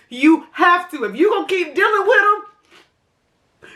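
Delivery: upset and high-pitched, pleading urgently; real agitation, voice climbing high; worked up. Real, not acted. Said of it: "I'ma calm down".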